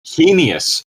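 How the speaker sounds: ordinary talk, nothing marked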